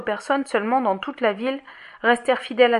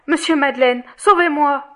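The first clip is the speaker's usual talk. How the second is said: loud, raised plea for rescue; anguished cry; 5 semitones higher, 6 dB louder than usual. A narrator's reading. Sick Fantine begs the mayor to save her, anguished cry. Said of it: "cria avec angoisse".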